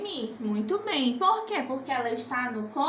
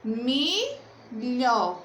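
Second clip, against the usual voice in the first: MILHO broken into "mi", pause, "lho"; syllable by syllable. Shown as a demonstration. Teacher finding the last syllable, "milho" split in two. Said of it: "qual é o último pedacinho".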